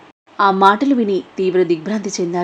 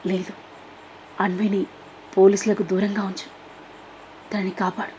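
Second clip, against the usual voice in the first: much softer, feeble and fading; weak dying voice; 8 dB quieter than usual. Acted. Dying father entrusting his daughter, weak and soft. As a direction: barely get the words out, like a dying man. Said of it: "నీరసంగా".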